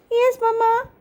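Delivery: put-on high-pitched child voice answering mom; high little daughter voice; little girl Rini.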